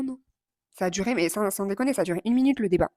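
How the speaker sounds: usual speaking voice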